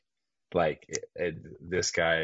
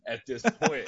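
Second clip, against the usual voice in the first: raised, mock-angry insistence with a laugh; imitated pissed-off pilot; 6 semitones higher, 4 dB louder than usual. Pilot telling the tower he will land, mock pissed-off defiance. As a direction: dig in, annoyed but amused. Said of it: "he just is so pissed off".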